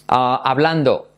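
ordinary talk, nothing marked

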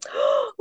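high-pitched gasp of delight; sharp, excited intake of breath. Real, not acted. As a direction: suck in a thrilled breath. Delighted reaction to sharing plan.

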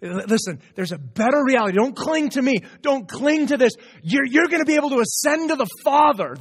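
lightly voiced Jesus pressing a better hope; urgent pleading. Jesus telling Mary there's a better reality, building urgent plea. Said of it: "Jesus is telling Mary".

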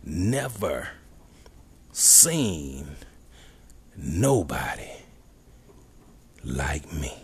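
never, seen, nobody each set apart by long pauses; every word its own beat; slow emphatic delivery. Shown as a demonstration. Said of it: "emphasize ain't".

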